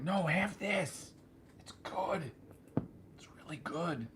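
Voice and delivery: very soft, sinking into a whisper; hushed coaxing. Retold or imitated. Jill urges him to try her drink, hushed and coaxing. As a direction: coax softly, imitating her.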